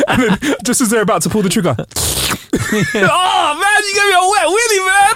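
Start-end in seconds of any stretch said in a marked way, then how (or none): 2.7-5.1 high, whiny outcry; drawn-out high-pitched complaint; mock distraught wail. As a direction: wail in comic outrage at a wet willy. Hunter outraged by wet willy.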